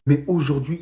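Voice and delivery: usual speaking voice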